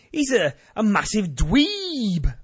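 high, drawn-out mock jeer; slow hammed-up heckle, long final word; Cena thing. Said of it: "as far as to do the Cena".

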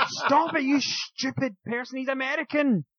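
Kevin Owens yelling. Retold or imitated. Retelling Owens yelling at Cesaro, high outraged mock shout. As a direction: screech it like you're scolding a teammate. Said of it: "Kevin Owens is going".

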